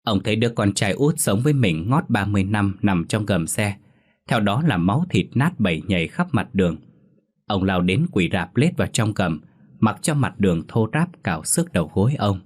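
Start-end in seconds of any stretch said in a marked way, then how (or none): none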